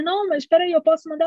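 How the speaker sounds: high, urgent stalling imitation; mock anxious pleading. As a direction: act out keeping mum on the line. Retells stalling mother on phone.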